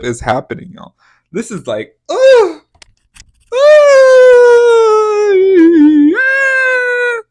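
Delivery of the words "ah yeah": very high, loud shriek of disgust; horrified shriek. Real, not acted. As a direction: shriek it out, appalled. Horrified reaction to photo.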